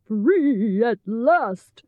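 slow, drawn-out lion voice; Cowardly Lion. A narrator's reading. Lion released from dark cellar, relieved. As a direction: stretch it out in relief.

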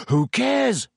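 high-pitched, dismissive impatience; impatient. A narrator's reading. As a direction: brush the objection aside impatiently. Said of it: "said Ron impatiently".

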